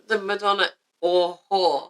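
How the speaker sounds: "or" set apart slowly between the nouns; slow the phrase down to correct it, leaning on "or"; stress on OR. Real, not acted. Correcting the other speaker's phrase.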